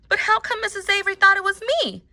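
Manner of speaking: high, quick, indignant question; puzzled girl. A narrator's reading. Girl asking the boy why she was blamed, high puzzled voice. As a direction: ask it in a high girlish voice.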